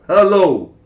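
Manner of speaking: loud, high mock hello with falling end; calling out. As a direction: imitate demanding an answer from a silent caller. Acted caller repeats into silence.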